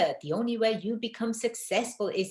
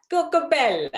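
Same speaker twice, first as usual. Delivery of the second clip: higher, louder, lilting Coco Bella like Italian men calling out; sing-song Italian men calling; 7 semitones higher, 5 dB louder than usual. Retold or imitated. Retelling what Italian men called her, lilting and higher. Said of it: "they were calling me Coco Bella".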